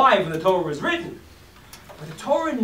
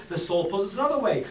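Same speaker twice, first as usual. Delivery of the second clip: ordinary talk, nothing marked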